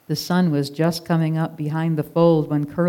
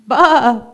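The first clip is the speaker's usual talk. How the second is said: loud, high startled sheep bleat; a frightened lamb's bleat; sudden scared baa; alarmed bleat; 7 semitones higher, 10 dB louder than usual. Acted. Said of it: "Daisy bleated in alarm".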